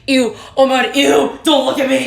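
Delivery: loud, high mock squeal of disgust; mock disgusted self. Herself, imagined crying in front of people, squealing in mock disgust. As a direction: play your own cringing self, hammed up. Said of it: "I'm like".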